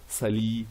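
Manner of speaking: "sali" with an ee sound for the U; mispronunciation demo. Shown as a demonstration. Teacher showing what not to say, ee ending. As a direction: end "sali" loosely on I instead of French U. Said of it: "and not".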